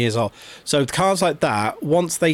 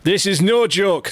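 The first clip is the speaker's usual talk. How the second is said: higher Geordie repeat of the line; Geordie accent; 6 semitones higher than usual. Pretend. Host rereads the poster's warning in Geordie. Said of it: "I'm gone Geordie".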